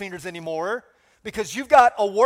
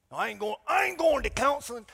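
put-on deep South Alabama accent; 3 semitones higher, 3 dB quieter than usual. Pastor mimicking a husband refusing counseling, Alabama accent. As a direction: do a stubborn man from deep South Alabama, low-key. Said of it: "that's my go-to impression voice".

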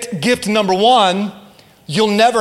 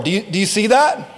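usual speaking voice

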